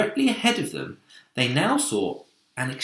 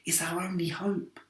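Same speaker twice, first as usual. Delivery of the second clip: hushed; 7 semitones higher, 6 dB quieter than usual. A narrator's reading. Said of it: "whispered James".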